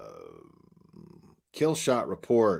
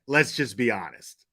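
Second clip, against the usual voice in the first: ordinary talk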